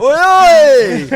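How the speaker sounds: hammed-up roofer chant, high and loud; laddish yell. Imitating roofers cheering the host, loud mock chant. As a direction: shout it like a rowdy lad at a gig. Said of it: "roofers chant imitated".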